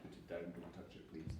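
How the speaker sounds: whispered don't touch it; unvoiced classroom aside; hushed warning. Real, not acted. Teacher quietly tells child off.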